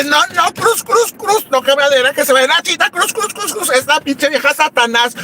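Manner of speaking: very high frantic chant of cruz; frantic warding off. Retold or imitated. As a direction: ward off the witch in panic. Ahuyenta a la bruja con cruces. (conjuro agudo y frenético)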